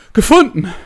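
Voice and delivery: high, loud gasped exclamation. A narrator's reading. Winded secretary corrects his angry boss, gasping it out. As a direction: force the word out as if short of air. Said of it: "japste er".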